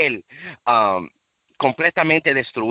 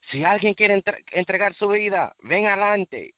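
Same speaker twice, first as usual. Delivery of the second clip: higher, calling preacher's invitation; preacher's altar call; 6 semitones higher than usual. Retold or imitated. Preacher calls the church forward, higher-pitched invitation. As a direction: invite the crowd forward like a pastor. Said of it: "fue como el predicador".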